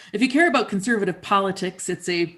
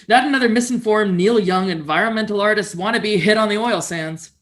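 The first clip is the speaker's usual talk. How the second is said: louder, scornful mock rant; scornful commenter; 4 semitones higher, 8 dB louder than usual. Reading a hostile comment on her article aloud, loud mock scorn. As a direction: sneer it like an online troll. Voicing an angry online commenter.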